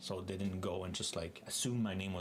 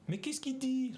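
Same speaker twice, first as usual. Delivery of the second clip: puzzled French person. Retold or imitated. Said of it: "they say".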